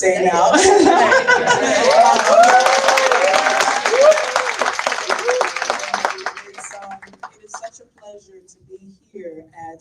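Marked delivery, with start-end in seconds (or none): 0.6-6.4 high laugh. Real, not acted. Host laughing after obligated remark.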